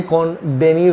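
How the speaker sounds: कॉन्वेनिर said as kon-BE-neer; wrong B sound. Shown as a demonstration. Teacher rejecting an error for learners, कॉन्वेनिर with B. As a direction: show the mistake, कॉन्वेनिर with B for V. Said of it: "नहीं, ना ही ये".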